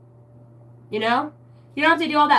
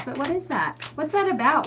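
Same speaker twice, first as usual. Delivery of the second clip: normal talking voice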